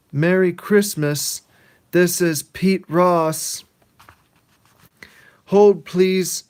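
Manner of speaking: high, loud, slow mock phone greeting; hammed-up stiff phone voice; Pete answering phone. Pete answering the ringing phone, hammed-up. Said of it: "He walks over and he says".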